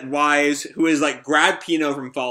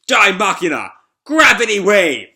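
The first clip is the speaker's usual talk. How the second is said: loud, high attack call; battle cry; 6 semitones higher, 8 dB louder than usual. Acted. Shiki launching a gravity attack, shouted battle cry. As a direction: shout the special move like an anime hero.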